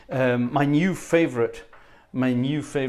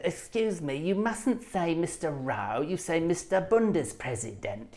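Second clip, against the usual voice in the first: higher, softer, slow fussy official voice; prissy official voice; 5 semitones higher, 4 dB quieter, slower than usual. Retold or imitated. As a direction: mimic a prim little bureaucrat correcting you. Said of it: "people who have those voices".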